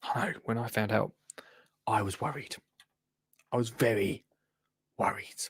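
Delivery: very soft and low, with pauses; hushed confession. Real, not acted. Host confiding in viewers about the twelve shows, hushed. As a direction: confide it under your breath.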